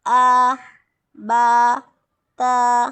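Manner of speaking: आ, बा, ता said loud, each with a short open a; teacher showing the fatha sound, said loud; fatha demo. Shown as a demonstration. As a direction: call out आ, बा, ता clearly with a middle-length vowel. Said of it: "ज़बर की आवाज़ अंग्रेज़ी के ए की आएगी".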